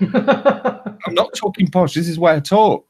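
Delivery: high-pitched retold denial; mock protest. Acted defence in a retold argument.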